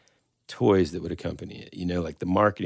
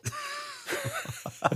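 laughing; 9 dB quieter than usual. Real, not acted. Laughs at silly creature name.

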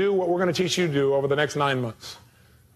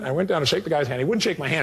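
ordinary talk

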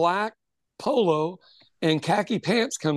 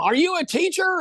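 mock yell; 11 semitones higher than usual. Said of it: "starts, you know, yelling".

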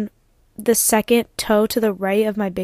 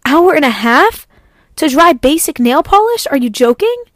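incredulous; 4 semitones higher, 3 dB louder than usual. Real, not acted. To the viewers about the front desk advice, incredulous and higher. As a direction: spell out how absurd it is. Incredulous at being told to wait.

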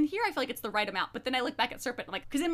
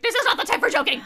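exasperated; 9 semitones higher, 8 dB louder, much faster than usual. Retold or imitated. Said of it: "I'm like".

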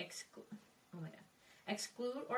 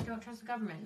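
ordinary delivery

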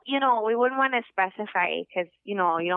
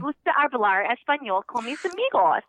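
Spanish said with a Minnesotan accent; 4 semitones higher than usual. Shown as a demonstration. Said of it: "do your accent with the Spanish".

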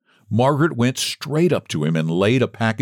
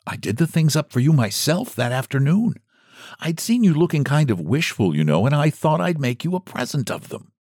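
put-on kind old storekeeper; 7 semitones higher than usual.

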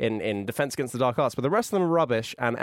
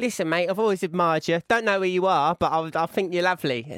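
lightly voiced matey charmer, pitched up; a hint of a cheeky, charming impression; Russell Brand; 4 semitones higher than usual. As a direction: charm them with chummy flattery. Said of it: "on a desert island with him, he would charm me".

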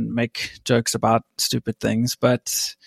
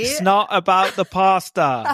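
a raised, lightly mimicked reality-star line; a hint of James Kennedy; James Kennedy impression; 6 semitones higher than usual. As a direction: toss off a quick celebrity imitation. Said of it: "that you sound like James Kennedy".

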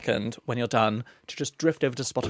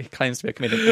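ordinary talk, nothing marked

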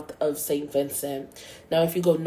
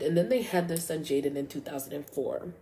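ordinary talk, nothing marked

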